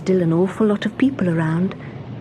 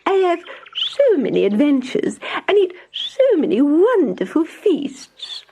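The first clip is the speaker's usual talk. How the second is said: boastful mouse; 9 semitones higher than usual. Acted. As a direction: show off your grand life.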